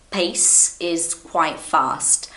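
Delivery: deliberately slowed speech; drawn out, unhurried pace. Shown as a demonstration. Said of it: "This is not my natural pace".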